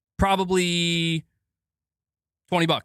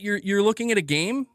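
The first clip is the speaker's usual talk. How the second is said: ordinary delivery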